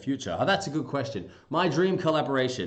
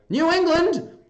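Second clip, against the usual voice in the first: high, loud attempt at a New England accent; exaggerated New England call, pitched up; 9 dB louder, slower than usual. Shown as a demonstration. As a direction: belt it out as a mock New Englander. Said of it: "That's how they talk".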